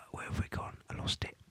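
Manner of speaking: whispered, barely audible aside; muttering to self. Real, not acted. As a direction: whisper to yourself while hunting for your place. Searching for place on page.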